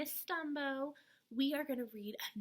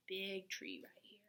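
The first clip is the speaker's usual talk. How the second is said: whispered aside; 5 semitones lower, 8 dB quieter than usual. Acted. Whispered aside pointing in book.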